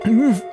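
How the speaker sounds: tearful whimper. A narrator's reading. Editor sobs into phone.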